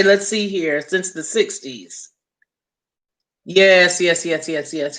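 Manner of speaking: ordinary talk, nothing marked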